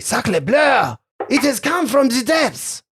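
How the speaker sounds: high, far French chef voice; French accent. Acted. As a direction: exclaim it like an excitable French cook. GM voices Saloni, French character.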